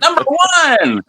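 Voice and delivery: slow, high drawn-out cry; mock crowd cry. A crowd jeering the wrestler, a slow, high mock cheer.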